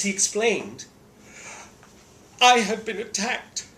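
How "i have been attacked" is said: high, breathless, posh outrage; breathless bishop. Acted. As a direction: gasp it out like a shocked bishop. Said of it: "in a breathless voice".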